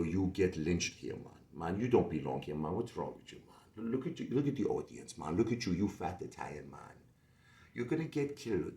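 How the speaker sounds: put-on quietly warning in a Jamaican accent; hushed Jamaican accent, a friendly warning. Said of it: "He go".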